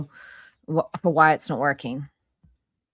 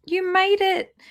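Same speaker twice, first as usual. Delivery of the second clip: mock cheer; 13 semitones higher, 6 dB louder than usual. Real, not acted. Streamer mockingly greets a viewer.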